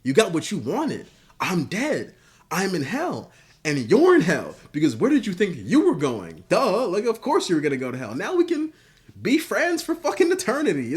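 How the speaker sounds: gloating Homelander. Retold or imitated. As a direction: rub it in like a delighted psychopath. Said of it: "And Homelander says".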